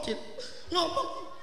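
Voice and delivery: ordinary delivery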